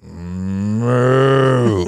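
loud, long mooing call; toy cow moo. Retold or imitated. Teller imitating the cow can in the meeting, loud moo. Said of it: "I turn and goes".

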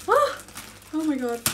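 high, loud oh of delight; thrilled oh; excited cry. Real, not acted. Reacting to the horse.

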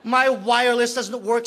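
normal talking voice

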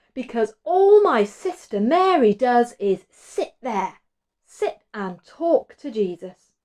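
loud, high, slow grumbling; moaning Martha. A narrator's reading. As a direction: complain loudly about the work. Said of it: "moaned Martha".

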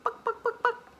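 high far clucking chicken voice; chicken clucks. Pretend. Voicing the chickens for a child, high clucks. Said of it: "and I think they say".

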